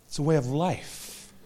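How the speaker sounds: a gentle reflective echo; soft echo. Shown as a demonstration. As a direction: let "It's a way of life" land quietly and slowly. Echoes line softer and slower.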